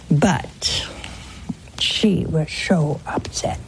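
slow hushed whisper, confiding. Real, not acted. Priest confiding a widow's story to viewers, whispered. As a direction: lean in and whisper it.

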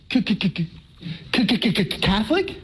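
comic stutter of disbelief; stammering shock. Retold or imitated. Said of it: "And I went".

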